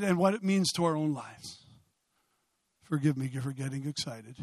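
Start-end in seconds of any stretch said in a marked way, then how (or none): 2.8-4.4 low, soft aside; subdued apology. Real, not acted. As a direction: drop right down to apologise. Apologises after impassioned preaching.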